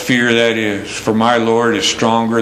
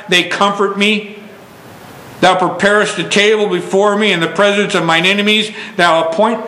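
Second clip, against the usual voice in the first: higher, quick fervent reading, settling down at the end; preaching scripture; 4 semitones higher, faster than usual. Acted. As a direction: proclaim the psalm, then let it rest. Reads psalm in preaching voice.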